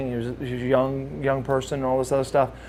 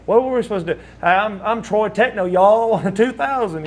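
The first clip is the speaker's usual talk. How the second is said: higher-pitched, hammed-up Southern accent; mock Southern accent; 6 semitones higher than usual. Wrestler making fun of his team name, in a mock Southern accent. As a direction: poke fun at your own Southern accent. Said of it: "I always made fun of it".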